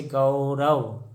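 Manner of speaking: Gaurav stretched as गौ-र-व; slow teaching breakdown of Gaurav; sounded out slowly. Shown as a demonstration. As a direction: draw out the औ in गौरव.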